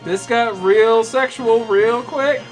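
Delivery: usual speaking voice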